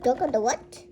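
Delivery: brisker, forceful repeated question. Pretend. Asking again about the loose duck, forceful. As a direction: punch it out as a firm question. Same question, louder take.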